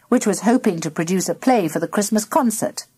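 plain reading voice

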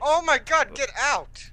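high panicked yell; panicked shout. Real, not acted. Being hit in the chest room.

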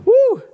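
loud high whoop of joy; excited whoop. Real, not acted. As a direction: let out a big victory whoop. Bolt finally turns.

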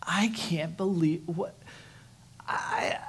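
barely voiced, breaking off; overwhelmed in the doctor's office; crushed whisper. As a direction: whisper it, lost for words. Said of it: "overwhelmed, that crushed moment".

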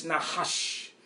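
Nahash said slowly as nah-HAHSH; low, drawn-out Nahash, nah-HAHSH; Hebrew pronunciation. Shown as a demonstration. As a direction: say Nahash with two open ah vowels and a final sh. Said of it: "the correct pronunciation of the word".

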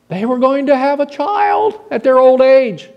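high, incredulous announcement; acted astonishment. Son telling of the angel's news to his father, high amazed voice.

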